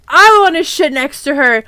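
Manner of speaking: hammed-up quarrelling shout; mock shout. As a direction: squabble loudly, stretching the last words. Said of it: "he sh-, shouted".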